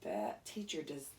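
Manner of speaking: plain reading voice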